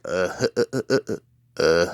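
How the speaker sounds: staccato, chuckling cartoon laugh; long stuttering "uh-huh-huh" laugh; crazy Butthead laugh. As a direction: imitate Butthead's dopey machine-gun chuckle. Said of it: "he'd always do this crazy laugh".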